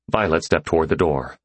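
plain reading voice

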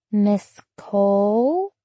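put-on slow soft baby voice, rising. A narrator's reading. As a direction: stretch it out like a toddler. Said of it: "Emma said in a baby voice".

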